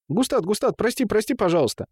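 high, repetitive apology; high pleading. A narrator's reading. Темул begs Густад to forgive him, high pleading gabble. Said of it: "высокий голос, бурно тараторивший".

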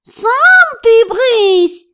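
high, loud, drawn-out yowl; yelling cat. A narrator's reading. Cat talks back to the house spirit, yowling. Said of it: "завопил Филя".

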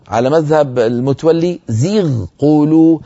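ordinary talk

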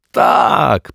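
a high, loud, eager yes; bursting with excitement; excited confirmation. Acted. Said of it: "potwierdził rozemocjonowany".

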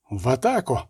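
plain reading voice

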